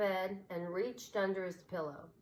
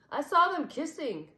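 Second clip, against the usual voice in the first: higher, louder donkey blurting it out; tattling donkey; 9 semitones higher, 8 dB louder than usual. A narrator's reading. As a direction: announce the gossip with glee. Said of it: "announced the Mexican donkey".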